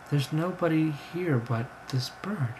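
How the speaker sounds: usual speaking voice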